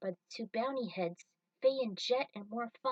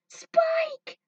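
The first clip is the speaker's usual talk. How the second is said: very high, breathy frightened call; desperate cry; 11 dB louder than usual. Acted. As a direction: call out from the freezing tube. Hostage Julia cries for help.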